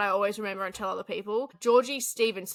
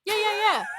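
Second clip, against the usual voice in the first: excited agreement; 11 semitones higher, 4 dB louder than usual. Real, not acted. Eager agreement.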